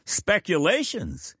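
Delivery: high, slowed exclamation; exclaimed. A narrator's reading. Dying scientist answers his questioner, exclaiming. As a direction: toss the word back, startled. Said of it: "exclaimed Faraday".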